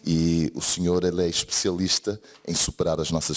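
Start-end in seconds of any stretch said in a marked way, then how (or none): none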